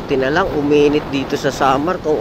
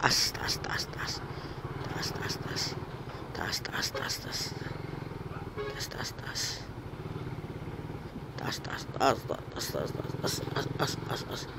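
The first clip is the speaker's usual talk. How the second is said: breathy whispered chant; whispering; 3 semitones lower, 19 dB quieter than usual. Real, not acted. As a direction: whisper it over and over, urging. Urging the bike uphill.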